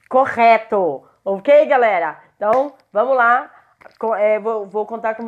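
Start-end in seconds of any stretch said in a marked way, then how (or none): none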